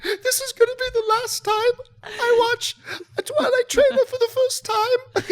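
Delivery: put-on very high wailing fangirl in English accent; hammed-up, high-pitched tearful impression; mock crying. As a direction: wail it through put-on sobs. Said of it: "And she's crying. She goes".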